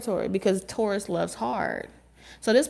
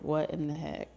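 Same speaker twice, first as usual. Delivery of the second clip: baffled mutter; 3 semitones lower, 7 dB quieter than usual. Retold or imitated. Spirit-person baffled.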